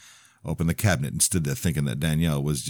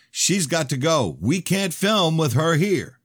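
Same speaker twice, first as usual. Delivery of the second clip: high, slow, raised-voice insistence; insistent demand; 13 semitones higher, 4 dB louder, slower than usual. A narrator's reading. Jeremy calling from the hallway that she must leave, insistent. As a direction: demand it loudly from down the hall.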